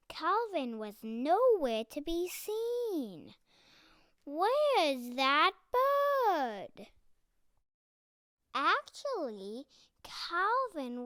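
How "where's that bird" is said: loud, slow call for a missing bird; teacher calling out. Acted. Flight teacher calls for the absent Calvin, loud and slow. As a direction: call out slowly, like a teacher taking roll.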